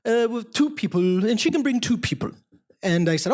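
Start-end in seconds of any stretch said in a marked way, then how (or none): 0.0-1.2 put-on high, hesitant French accent; Pierre. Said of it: "he was from France". 1.2-2.3 put-on high French-accented voice; Pierre. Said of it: "he was from France".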